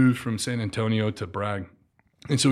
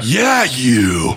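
mock call-out; 3 semitones higher, 8 dB louder than usual. Mock cadre calling someone out.

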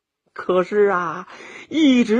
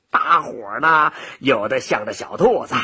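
ordinary delivery

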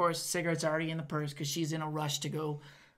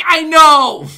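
shouted retort; 10 semitones higher, 23 dB louder than usual. Retold or imitated. Snapping back at manager.

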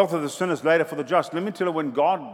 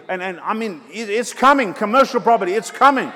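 preaching at full force; 5 semitones higher, 7 dB louder than usual. Acted. Preaching to congregation.

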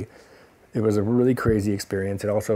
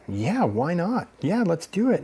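eager acceptance; 9 semitones higher, 3 dB louder, slower than usual. Retold or imitated. Acts eager acceptance on phone.

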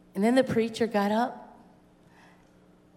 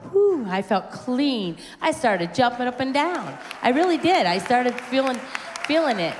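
higher, slightly louder burst of joy; elated testimony; 4 semitones higher, 4 dB louder than usual. Retold or imitated. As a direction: relive the thrill of feeling clean. Reliving joy after baptism.

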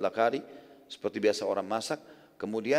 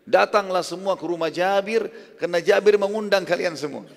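imitated shout; 7 semitones higher, 7 dB louder than usual. Prophet invites everyone to Jabir's house, imitated shout. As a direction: call everyone over at raised pitch. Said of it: "berdiri di atas batu yang tinggi teriak".

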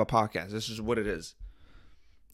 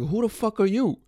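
high-pitched imitation of an affronted girl; imitated sharp, high comeback; mock indignant challenge; 11 semitones higher, 4 dB louder than usual. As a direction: throw it back high and offended. Girl challenges caller, retold.

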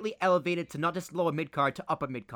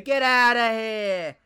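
mock New York; 5 semitones higher, 7 dB louder, much slower than usual. Friends trade mock New York lines.

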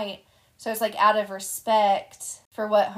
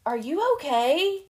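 concerned guy; 7 semitones higher, 4 dB louder, slower than usual. Retold or imitated. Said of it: "I hear him go".

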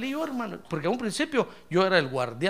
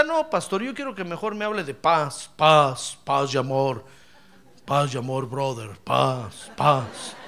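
lower, slow, dreamy mock of a peace-and-love listener; 7 semitones lower, slower than usual. Pastor mocking a listener who only wants to hear about peace, lower and slow with a laugh. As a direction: drag out paz like a lazy hippie, half laughing. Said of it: "tal vez usted dirá".

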